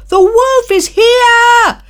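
high, loud, slow panicked yell; boy pleads with villagers who ignore him; desperate yell. Acted.

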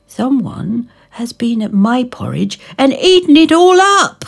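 put-on baby bear upset his porridge is gone; crying baby bear. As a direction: cry it out like an upset cub. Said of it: "cried Baby Bear".

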